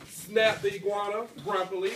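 usual speaking voice

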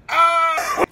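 sudden loud, high cry; high wail. Acted. Host overcome about friends, high anguished cry. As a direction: burst out with an anguished yell. Outburst amid tearful thanks.

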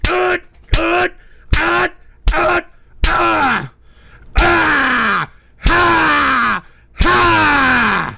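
wordless furious yelling; raging screams. Real, not acted. Streamer screaming at chat, raw angry yells. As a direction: roar out the frustration.

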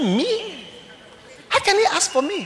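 high-pitched incredulous question; acted disbelief. Preacher hearing the sick man asked for him, incredulous. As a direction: react loudly, high and astonished. Reacts to surprising summons.

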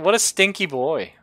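mock disgust. Real, not acted. Mocking the monster after fleeing.

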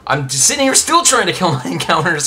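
loud, high-pitched frustrated complaint; fed-up outburst with a laugh breaking in; exasperated. Real, not acted. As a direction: raise pitch and volume in exasperation. Frustrated at failing to catch.

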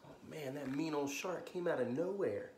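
ordinary delivery

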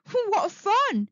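high, playful exclamation; a touch of giggly delight. A narrator's reading. Smiley Shark admires the twirling sea star, giggly. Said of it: "giggled Smiley Shark".

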